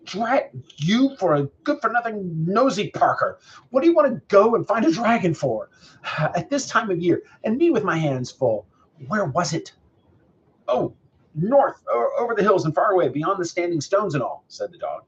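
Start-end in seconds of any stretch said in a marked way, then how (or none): 0.0-9.7 high, agitated farmer's rant; acted huffy telling-off in a raised pitch; exasperated scolding. A narrator's reading. 10.7-14.4 high, louder stammering answer; reply as a nervous dog, stumbling at first; raised-pitch dog voice, hesitant then rushing on; flustered dog. A narrator's reading. Dog answers the angry farmer.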